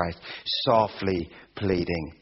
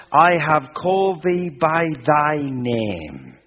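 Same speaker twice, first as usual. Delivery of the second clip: slow, louder, raised proclamation; preaching God's words; 5 semitones higher, 5 dB louder, slower than usual. Acted. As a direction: declare it slowly like God speaking. Quoting God in sermon.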